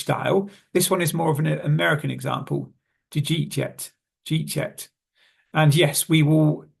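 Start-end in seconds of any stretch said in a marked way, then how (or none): 3.1-3.9 "Did you eat yet" squeezed to "didja eetchet"; quick, linked "Did you eat yet"; condensed American phrasing. Shown as a demonstration. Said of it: "condense or mash together". 4.2-4.9 "Did you eat yet" squeezed to "jeet yet"; "Did you eat" collapsed into one syllable, "jeet"; mashed together. Shown as a demonstration. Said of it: "condense or mash together".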